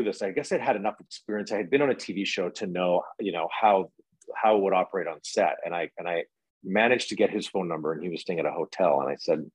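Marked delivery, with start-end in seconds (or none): none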